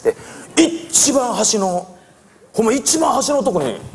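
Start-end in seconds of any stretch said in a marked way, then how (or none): none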